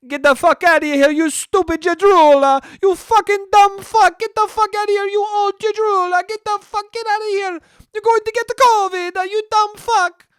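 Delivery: high, loud hammed-up Italian rant; over-the-top mock Italian scolding; angry Italian yelling. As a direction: shriek it like a furious Italian caricature. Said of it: "They're probably like".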